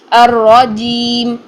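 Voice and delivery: "الرجیم" recited very slowly with a firm voiced j; correct hard jeem. Shown as a demonstration. Tajweed teacher repeating the right sound, hard jeem. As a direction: stretch "الرجیم", keeping the j hard. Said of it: "یہ sound آنی چاہیے".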